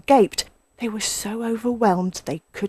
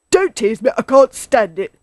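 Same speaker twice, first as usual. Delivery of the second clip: loud, higher, pained plea; distressed badger protest; crying out; 4 semitones higher, 8 dB louder than usual. A narrator's reading. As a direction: beg him to stop. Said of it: "cried Badger".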